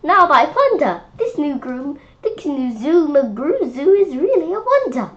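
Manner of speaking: high, loud amazed exclamation; amazed crowd. Acted. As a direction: gasp it out like an astonished visitor. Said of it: "they'll be like".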